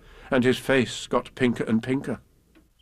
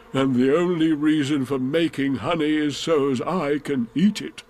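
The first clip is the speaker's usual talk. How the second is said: a touch of eager, pondering bear; lightly voiced pleased bear; 3 semitones higher than usual. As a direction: bear reasoning towards a happy conclusion.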